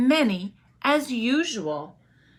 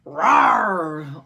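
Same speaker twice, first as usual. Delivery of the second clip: loud low mock growl; bear growl; 5 semitones lower, 6 dB louder than usual. Bear demanding jam, a loud low growl. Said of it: "Old Bear growled".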